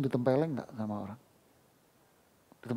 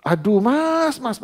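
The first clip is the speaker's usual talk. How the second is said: high, loud startled yell at the door; a resident yelling at an unexpected visitor, high and loud; mock yell; 10 semitones higher, 8 dB louder, much slower than usual. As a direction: shout at the stranger in alarm. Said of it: "paling diteriakin Anda".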